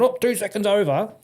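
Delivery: high, sneering petty inspector; gleefully catching someone out; mock petty inspector. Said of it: "I'm not walking out going".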